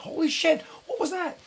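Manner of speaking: very high imitation of shock; shocked exclamation. Crew dodges a speeding balloon, shocked.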